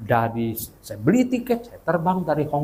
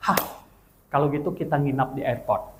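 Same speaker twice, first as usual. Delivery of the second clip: ordinary talk, nothing marked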